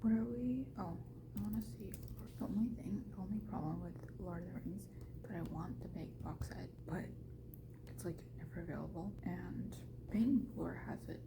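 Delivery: hushed whisper in a store; quiet whisper so no one hears. Real, not acted.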